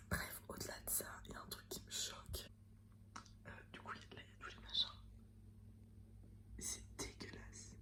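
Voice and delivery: soft whisper in public. Real, not acted. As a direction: whisper so nobody hears. Whispers in the pharmacy.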